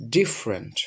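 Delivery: ডিফারেন্ট said in two syllables, "dif-rent"; correct pronunciation. Shown as a demonstration. Teacher modelling the correct form for a student, ডিফারেন্ট as "dif-rent". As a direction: drop the middle vowel of ডিফারেন্ট: "DIF-rent". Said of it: "সঠিক উচ্চারণ হবে হচ্ছে".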